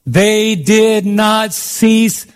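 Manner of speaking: slow, deliberate scripture reading; weighty, stretched-out preaching delivery; slow emphatic reading. Real, not acted. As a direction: draw out each word for the congregation.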